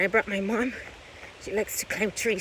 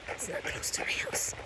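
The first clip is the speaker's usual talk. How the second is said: whispering; 8 dB quieter than usual. Real, not acted. Whispering on the quiet trail.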